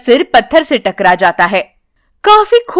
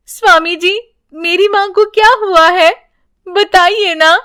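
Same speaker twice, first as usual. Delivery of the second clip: put-on anxious child; 7 semitones higher than usual. Child asks holy man anxiously.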